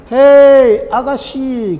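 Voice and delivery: high, loud bird call; cheeky catcall. Acted. Parrot catcalling the woman in the street, loud and high. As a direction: hail her like a cheeky parrot.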